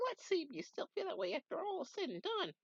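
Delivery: very high, fatherly put-on voice; squeaky old sage giving advice; high old mentor voice.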